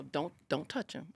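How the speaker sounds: quiet guard's order; low stern warning. Retold or imitated. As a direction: warn low and firm. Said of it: "they go".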